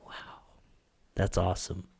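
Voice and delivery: hushed, low wonder; soft, low, moved murmur; quiet awe. Retold or imitated. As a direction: say it softly, taking it in. Moved reply retold after hearing praise.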